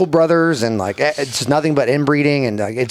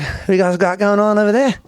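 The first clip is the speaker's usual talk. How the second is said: higher-pitched Aussie impression; Australian accent; 7 semitones higher than usual. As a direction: imitate a curious Australian bloke. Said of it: "here comes the Australian guy".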